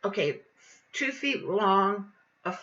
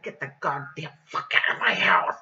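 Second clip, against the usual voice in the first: harsh, whispered yell; lower, rasping whispered outburst; furious hiss; 6 semitones lower than usual. Retold or imitated. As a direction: order them out in a forceful whisper. Retold angry order to leave.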